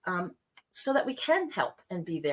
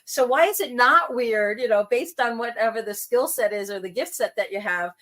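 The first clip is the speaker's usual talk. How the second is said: ordinary delivery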